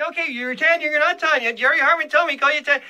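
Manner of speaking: normal talking voice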